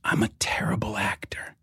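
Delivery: voiceless whisper into the ear. Retold or imitated. Malkovich leaning across the poker table, whispering. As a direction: confide it in a hushed whisper. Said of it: "he whispers in my ear".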